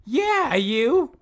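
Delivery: loud accusing. Real, not acted. Accusing friend after scare.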